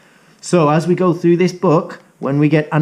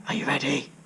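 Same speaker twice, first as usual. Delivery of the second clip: plain reading voice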